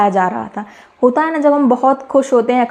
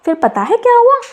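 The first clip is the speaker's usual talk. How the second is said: excited teaser; 11 semitones higher than usual. A narrator's reading. Storyteller teases the listeners.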